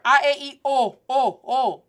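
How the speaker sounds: loud, pure O, lips closed in; closed Spanish vowels. Shown as a demonstration. Teacher reminding learners of the vowels, closed O. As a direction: drill A, E, I, O with a closed O. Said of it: "La O es cerrada".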